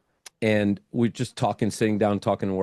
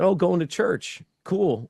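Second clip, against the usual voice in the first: high, bright put-on interest; forced enthusiasm; 9 semitones higher, slower than usual. To his date, hiding surprise with a cheery voice. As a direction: cover the shock with an eager cool.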